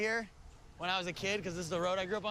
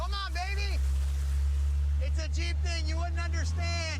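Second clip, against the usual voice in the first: loud, high hype yell; loud shout; 10 semitones higher, 7 dB louder than usual. Real, not acted. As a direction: belt it out like a cheer. Revving jeep, shouting a slogan.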